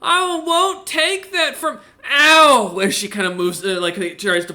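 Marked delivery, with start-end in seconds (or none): 0.0-1.8 high, slow, indignant woman's cry; angry woman. Acted. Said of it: "you hear her go". 2.1-2.8 loud high "ow" of pain; yelp of pain. Acted. Woman hit by an arrow.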